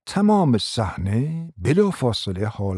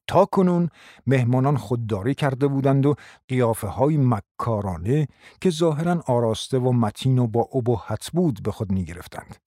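usual speaking voice

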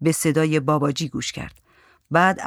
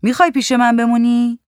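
raised, higher voice; louder, lifted questioning; 6 semitones higher, 5 dB louder than usual. A narrator's reading. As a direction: ask it out loud across the room. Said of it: "حقیقی بلند گفت".

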